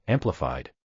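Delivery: ordinary delivery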